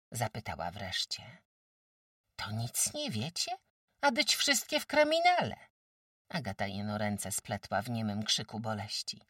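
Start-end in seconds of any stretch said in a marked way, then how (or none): 2.4-5.6 higher, louder village farmwife; a brisk countrywoman sizing up a sick guest; sturdy farmwife. Acted. Farmwife Kłębowa tells old Agata she's too weak, brisk.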